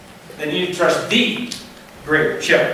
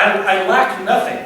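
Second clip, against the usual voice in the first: usual speaking voice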